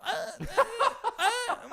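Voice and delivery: very high, squeaky dithering; squeak, caught between yes and no; obnoxious hedging. Acted. Host imitates people caught between yes and no, high squeak. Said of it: "caught between yes and no".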